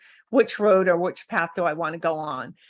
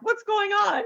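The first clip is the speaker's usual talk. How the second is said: confused; 13 semitones higher than usual. Retold or imitated. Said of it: "And they're like".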